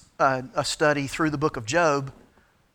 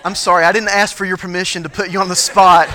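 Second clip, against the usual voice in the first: loud, amused apology, pitched up; playful, raised-voice sorry; laughing apology; 4 semitones higher, 12 dB louder than usual. Real, not acted. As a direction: apologise through the laughter, loudly. Joking apology over audience laughter.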